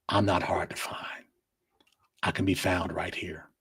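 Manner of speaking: soft hushed warning; whispered parting challenge; menacing whisper. Real, not acted. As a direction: breathe it low like a quiet threat. Sign-off taunt to haters.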